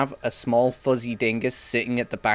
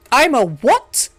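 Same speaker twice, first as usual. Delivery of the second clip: Harry voice; 13 semitones higher, 10 dB louder, much slower than usual. Retold or imitated. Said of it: "go around saying".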